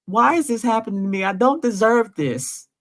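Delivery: mild retold sob in the voice; imitating his crying. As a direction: echo his broken, tearful plea. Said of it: "He was crying".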